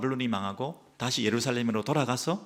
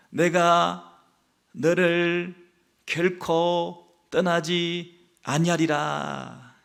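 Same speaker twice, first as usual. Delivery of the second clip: higher, louder, slow sing-song prayer; childlike prayer; 6 semitones higher, 4 dB louder, much slower than usual. Pretend. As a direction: recite it like a little child praying. Praying like a child, hands together. (높고 크게 천천히 읊는 아이 기도)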